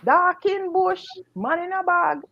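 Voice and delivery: high imitated chant, drawn out; mock broken-English call from the bag; Jamaican Chinese man. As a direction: imitate his cry high, phrase by phrase. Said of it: "and him a say".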